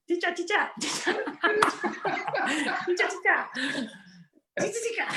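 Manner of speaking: very high mock Hong Kong accent; hammed-up, high-pitched Hong Kong caller. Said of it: "Hong Kong accent".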